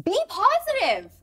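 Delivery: high, loud imitated call-out; retold yell. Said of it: "she yelled out".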